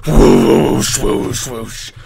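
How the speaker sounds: breathy whoosh noises fading away; mouthed sound effect, trailing off; imitated whooshing. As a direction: imitate a loud swish, then fade. Imitates loud grass-picking sound.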